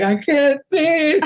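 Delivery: high, slow, helpless whimper; stretched-out high plea from under the shorts; muffled panic. Retold or imitated. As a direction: play the guy blinded by his own boxers. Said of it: "and he was like".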